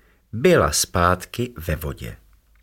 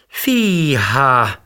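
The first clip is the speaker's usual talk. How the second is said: higher and louder wow; amazed; 6 semitones higher, 4 dB louder than usual. A narrator's reading. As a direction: react with delight. Excited reaction to news.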